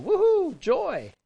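Woohoo leaps way up, loud and jubilant; joyful whoop. Retold or imitated. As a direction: cheer it out like great news arrived. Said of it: "And I can imagine them".

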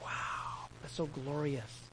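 whispered wow, slow and very soft; hushed tearful awe. Acted. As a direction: breathe it out in acted reverent wonder.

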